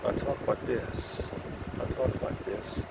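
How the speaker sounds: soft, slow whisper; whispered self-presentation voice, slowed down; put-on voice. Shown as a demonstration. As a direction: hush it like a practised charm voice. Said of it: "This is not how I usually talk".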